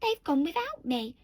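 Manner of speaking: a touch of a lament; lightly acted cry. A narrator's reading. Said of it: "And he cried".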